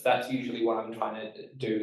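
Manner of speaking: ordinary delivery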